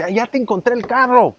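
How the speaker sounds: lightly acted cheerful caller; imitating the dealer. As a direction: playfully mimic the car guy's upbeat announcement. Car dealer calls with news.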